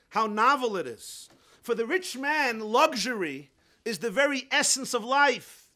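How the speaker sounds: high, loud, driving sermon delivery; impassioned preaching. Real, not acted. Preacher explaining the poor man's excitement, impassioned. Preacher builds up the parable at force.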